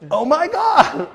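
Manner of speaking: high, loud, slow and laughing; joyful laughing exclamation. Retold or imitated. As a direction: burst out in delighted disbelief. Joyful reunion moment.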